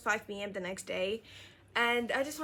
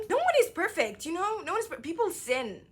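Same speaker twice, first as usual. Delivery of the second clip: high, loud insistence; emphatic; 9 semitones higher, 4 dB louder than usual. Real, not acted. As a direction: push the point up high. Emphatic point to viewers.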